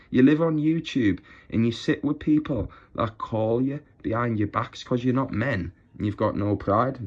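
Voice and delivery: ordinary talk, nothing marked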